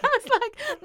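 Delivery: normal talking voice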